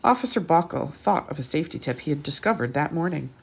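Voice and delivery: put-on lively read-aloud to children; bright picture-book narration; storybook reading. A narrator's reading.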